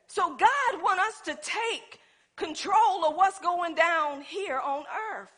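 ordinary delivery

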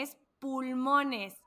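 slow correcting. Retold or imitated. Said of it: "le dije".

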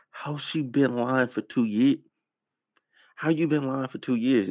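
incredulous. Real, not acted. Incredulous at the testimony.

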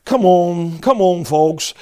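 ordinary talk, nothing marked

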